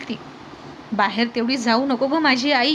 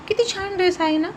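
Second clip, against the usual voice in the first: much higher, swooning over the dress; gushing tipsy delight; 8 semitones higher than usual. A narrator's reading. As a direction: coo over it like a delighted child. Drunk wife admiring dress. (नशेत उंच, कौतुकाच्या सुरात)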